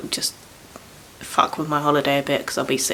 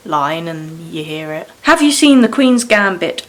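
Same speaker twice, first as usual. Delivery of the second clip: ordinary delivery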